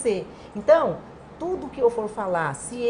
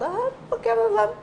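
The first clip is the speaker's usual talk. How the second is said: much higher mock-loud voice; imitated loud talk; 14 semitones higher than usual. Leader arrives talking to the team, mock loud. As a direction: imitate someone arriving talking loudly, pitched way up. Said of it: "ele chega falando alto".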